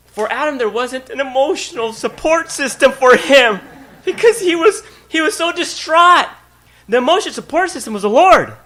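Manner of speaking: loud, high preaching build on work; full-force preaching. Acted. Pastor preaching to the congregation about work, loud and high. Preaching climax on emotional support.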